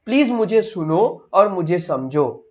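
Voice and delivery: ordinary talk, nothing marked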